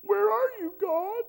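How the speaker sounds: high, loud pouty call; pouting Samson. Retold or imitated. Samson pouting and calling out to God, high and loud. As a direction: pout it up at the sky. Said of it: "And he pouted".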